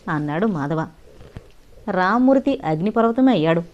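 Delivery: ordinary delivery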